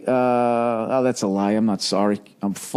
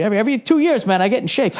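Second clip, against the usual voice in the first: fast, laughing, higher; 8 semitones higher, much faster than usual. Real, not acted. Laughing at himself to his listeners. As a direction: chuckle through the line.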